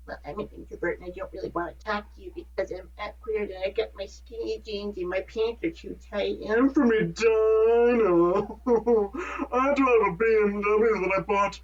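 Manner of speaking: lightly put-on snobby voice, laughing by the end; mock the rich suburb kid, breaking into laughter; hammed-up Edina snob, cracking up; snooty Edina hipster. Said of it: "not that people from Edina actually talk like that".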